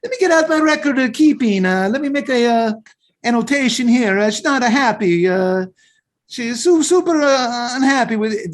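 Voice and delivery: high-pitched hammed-up Italian accent; Italian guy. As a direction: put on a cartoon Italian accent, high and fussy. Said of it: "that little Italian guy back there was like".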